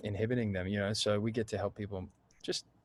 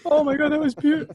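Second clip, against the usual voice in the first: very high, loud, fast gushing; gushing auntie; 10 dB louder than usual. Retold or imitated. Aunt gushing over the girl's song, high and loud. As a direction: swoon like a moved aunt over tea. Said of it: "she'd be like".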